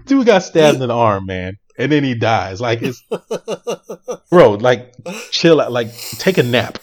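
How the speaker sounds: loud, laughing disbelief; mock the scene through laughter; giggly exasperated outburst; laughing rant. Real, not acted. Mocking the death scene.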